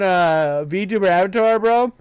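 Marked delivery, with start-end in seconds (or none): none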